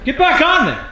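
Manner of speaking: shouting. Real, not acted. Hyping friend on the machine.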